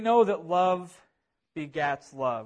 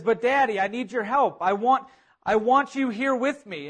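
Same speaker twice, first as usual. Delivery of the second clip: higher, louder imitation of a boy begging; pleading child; 5 semitones higher, 4 dB louder than usual. Scared boy begs his dad to come, pleading. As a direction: plead lightly as a scared little son. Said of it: "And he says".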